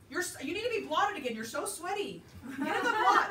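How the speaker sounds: high, fast teasing banter; playful teasing call. Real, not acted. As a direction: tease the sweaty cook, rising to a shout. Teasing the sweaty cook.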